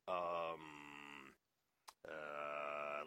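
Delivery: drawn-out, low mock hesitation; imitated reluctance, low and lingering; reluctant hemming. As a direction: stall with long, soft filler sounds. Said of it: "like, not wanting to tell Kirk".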